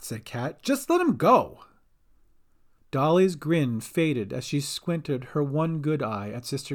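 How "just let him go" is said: high, urgent plea; desperate plea. A narrator's reading. Cat begs Dolly to free Bink, desperate plea. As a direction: plead urgently, pitched up.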